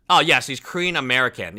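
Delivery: usual speaking voice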